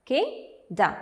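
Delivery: "Kingdom" split into two separate beats; syllable by syllable. Shown as a demonstration. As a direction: break "Kingdom" into king, pause, dom. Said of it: "So there are two syllables".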